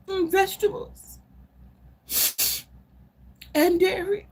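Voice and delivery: high, tearful, near-shouting plea of a desperate mother; distraught mother. Pretend. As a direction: wail it like a mother at her wits' end. Distraught mother about daughter's eating.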